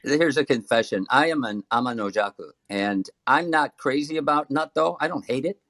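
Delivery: ordinary talk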